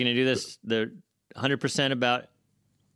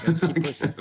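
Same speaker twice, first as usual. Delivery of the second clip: rallying, pushing back with a louder voice; louder rallying repeat; 7 semitones higher, 5 dB louder, faster than usual. Real, not acted. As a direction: say it again louder, like a battle cry.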